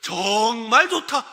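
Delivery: loud, slow, heavily leaned-on praise; drawn-out emphasis. Acted. Preacher straining for stronger praise, drawn-out emphatic. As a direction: stretch 정말 long and loud, then 좋다.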